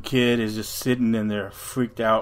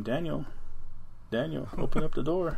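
higher, coaxing call through the door; mimicked mother calling; 5 semitones higher than usual. Monster imitating his mother at the door, higher coaxing call. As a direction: lure him like his mom would. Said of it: "his mother's voice saying his name".

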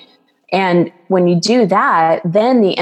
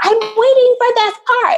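much higher, shrill imitation of an angry driver; mock yell. Woman in the next car yelling at the speaker, high mocking shriek. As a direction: squeal it like a woman losing her temper over a parking spot. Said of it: "starts yelling at me in her car".

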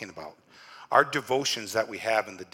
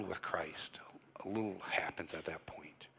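hushed sermon whisper; 4 semitones lower, 10 dB quieter than usual. Real, not acted. Pastor drops to intimate whisper.

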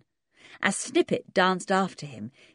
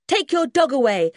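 high, loud and fierce; fierce demand; 9 semitones higher, 7 dB louder than usual. Acted. Twins telling the children to remove their dog, fierce. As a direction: bark the order at them. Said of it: "said both twins fiercely".

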